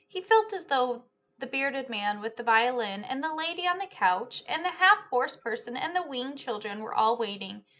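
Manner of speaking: ordinary delivery, nothing marked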